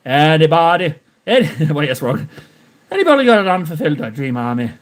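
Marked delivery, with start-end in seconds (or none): none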